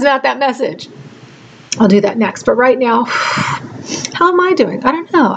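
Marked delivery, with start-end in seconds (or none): 3.0-5.3 slow, high, tearful question; a real sigh, then fight back tears; still teary. Real, not acted. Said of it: "I just had a little crying spell".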